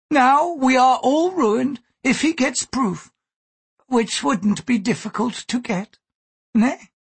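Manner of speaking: high, slow, bitter warning. A narrator's reading. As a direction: warn bitterly in a high character voice. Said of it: "added bitterly".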